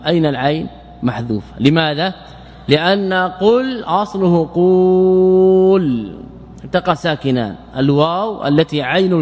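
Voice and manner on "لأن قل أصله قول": قول stretched long, sung slowly; drawn-out base form. Shown as a demonstration. Teacher sings out قول for students, slow and drawn. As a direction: chant the word قول out slowly to show the hidden waw. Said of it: "teacher shows the base form".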